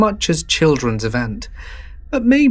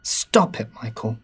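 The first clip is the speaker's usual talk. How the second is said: understated, creeped-out protest; lightly acted protest that drops away; mild cry of protest; 3 semitones lower, 4 dB quieter than usual. A narrator's reading. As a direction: voice a girlfriend's uneasy objection. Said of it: "she cried".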